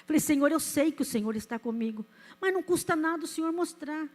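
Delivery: ordinary talk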